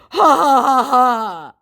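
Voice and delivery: high, loud taunting ha-ha; a smug fake laugh mocking the victim; mocking scammer laugh. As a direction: cackle like you've just scored a point.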